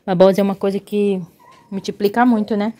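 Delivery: normal talking voice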